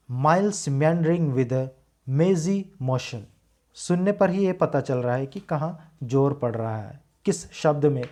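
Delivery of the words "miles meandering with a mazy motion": slow, measured stress on MILES, MEANDERING, MAZY, MOTION; stressed syllables. Shown as a demonstration. Teacher repeats the stressed words so students hear it, stressed syllables. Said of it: "और stress जो पड़ा है".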